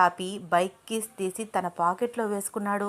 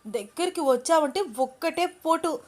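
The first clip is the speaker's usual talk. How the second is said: shaky bravado; 7 semitones higher than usual. A narrator's reading. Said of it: "వణుకుతున్న చేతులతో".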